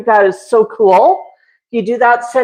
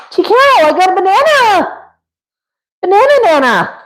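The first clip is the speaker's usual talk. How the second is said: high sing-song call to a bird; pet-calling voice; 8 semitones higher than usual. Real, not acted. Owner tempting Tico with a banana, high sing-song. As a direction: lift it up and sing the name.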